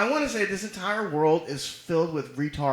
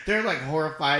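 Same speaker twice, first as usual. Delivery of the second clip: ordinary delivery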